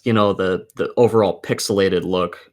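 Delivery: ordinary delivery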